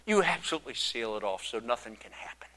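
breathy whisper, dismissive; whispered brush-off to the chief priest; whispered aside. Retold or imitated. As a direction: hush it like a weary aside.

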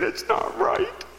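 very high, slow mock crying; tearful man. Upset man protests to his pastor, high tearful wail. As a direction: drag out a wailed imitation of an upset man. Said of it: "he was just a-crying".

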